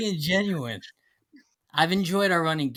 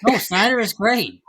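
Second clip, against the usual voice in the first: loud, pitched-up insistence with GREAT swooping; upward inflection; 5 semitones higher, 5 dB louder than usual. Real, not acted. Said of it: "saying everything with an upward inflection".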